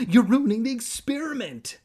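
high, loud, put-on kid voice; protesting. A narrator's reading. Kermit objects to losing his tarantulas, protesting shrilly. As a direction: squeal a loud protest as an upset boy. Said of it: "Kermit protested".

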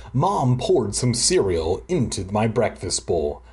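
ordinary talk

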